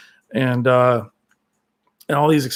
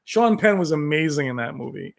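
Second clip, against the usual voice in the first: ordinary delivery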